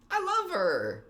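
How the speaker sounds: very high-pitched fangirl squeal; gushing adoration. Real, not acted. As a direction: gush it way up high. Gushing over favourite character Emily.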